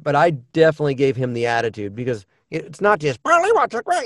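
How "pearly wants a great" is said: very high squawky parrot. Shown as a demonstration. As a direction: chirp it like a cartoon parrot. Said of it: "they wanted a parrot".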